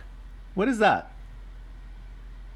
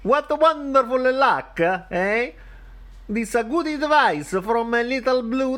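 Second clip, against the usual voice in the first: high, hammed-up Italian accent; mayor speechifying in a broad Italian accent; 10 semitones higher than usual. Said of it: "in an Italian accent".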